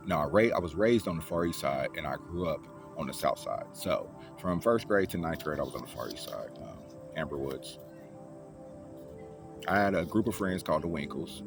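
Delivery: ordinary talk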